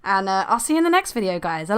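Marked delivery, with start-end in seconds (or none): none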